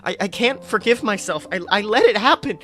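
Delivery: spiralling into guilt, words tumbling out; grieving Hufflepuff blaming himself to his friend, high and panicked; panicked rant. Acted. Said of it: "full-blown panic attack".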